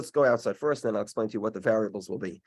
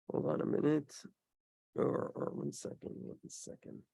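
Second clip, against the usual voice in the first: whispered aside; 3 semitones higher, 11 dB quieter than usual. Real, not acted. Teacher pauses to check text.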